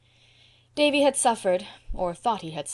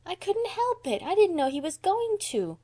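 put-on much higher, flustered little girl; embarrassed child's wail; crying out; 12 semitones higher than usual. A narrator's reading. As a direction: protest your innocence in a high voice. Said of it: "cried Dora".

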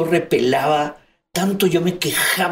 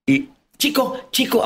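urgent calling; 9 semitones higher than usual. Retold or imitated. Calling brother, pitch up.

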